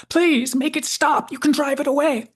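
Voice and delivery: high, loud hammed-up panic; shrill-pitched mock horror, cried out loudly; terrified cry. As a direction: imitate a cowering man's desperate plea. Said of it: "crying out in horror and fear".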